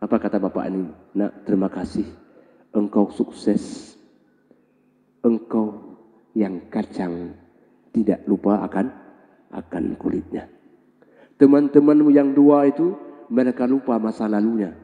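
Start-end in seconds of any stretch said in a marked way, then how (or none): 1.1-10.5 gentle blessing. Acted. The old man blesses him.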